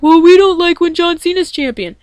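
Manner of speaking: high, loud mock fan voice; mock-whiny high voice imitating the other fans. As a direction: put on a whiny fan voice to mimic critics. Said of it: "a lot of people are probably like".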